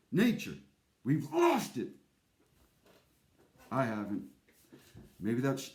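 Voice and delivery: ordinary talk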